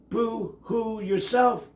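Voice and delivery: ordinary delivery, nothing marked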